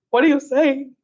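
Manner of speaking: high, incredulous question; retold disbelief. Speaker retelling her reply to a surprise offer, high and incredulous. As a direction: ask it as if stunned, voice up.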